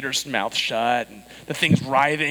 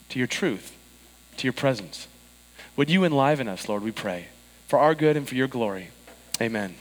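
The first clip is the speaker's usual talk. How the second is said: prayer; 4 semitones lower than usual. Acted. Pastor's opening prayer.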